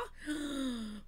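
soft, drawn-in gasp; breathy intake of astonishment; amazed gasp. Real, not acted. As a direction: gasp at what you spotted. Spotting the dragon claw.

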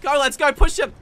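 loud urgent commands; rally the squad, loud; shouting orders over battle. Real, not acted. Urging team to push.

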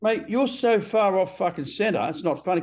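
half-acted scolding from a mate; quoting his advisor. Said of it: "He goes".

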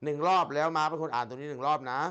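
usual speaking voice

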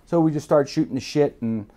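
usual speaking voice

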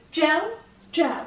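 جل spoken slowly as an English word; جل with an English J, not the Persian ژ; careful English word demo. Shown as a demonstration. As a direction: say جل with a clear English J sound, like gel. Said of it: "باید بگید جل".

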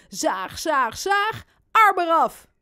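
building to a high, loud finish; mock-menacing play. Robin pretends sawing baby's arm off, playful loud menace. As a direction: build up and burst out on the last words.

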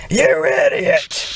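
slow, sneering evil flower voice; evil Flowey. Acted. Flower turns on the player, sneering slowly. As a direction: drag out the insult like a villain.

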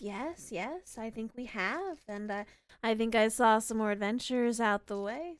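soft, airy, gleeful hag voice. Acted. Second hag gloating over the cages, airy and soft. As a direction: savour the captives like a pleased witch. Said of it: "that airy voice from the hag".

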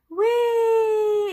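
high, loud gleeful squeal; playful whee. Pretend. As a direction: squeal like it's a slide ride. Paint gliding along the tail.

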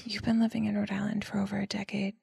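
whispered teasing jab. Real, not acted. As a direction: whisper it as a playful dig. Teasing cohost about home state.

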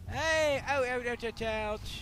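loud repeated ouch yelps; yelping in pain. Real, not acted. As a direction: yell out as you get hit. Character getting hit in game.

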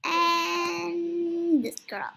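slow, loud gushing coo. Real, not acted. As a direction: stretch it out adoringly. Cooing over cute avatar.

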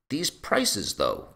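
lightly voiced objecting lord, pitched high; contesting fat lord. A narrator's reading. Fat Lord Belmore contesting prices, high-pitched objection. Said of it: "contested fat Lord Belmore".

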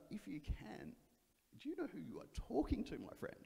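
mock-offended with a knowing edge; lightly voiced offended Jesus; mock indignant.

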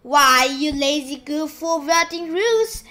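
loud angry shout. Acted. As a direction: yell it furiously. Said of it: "and Spiker shouted".

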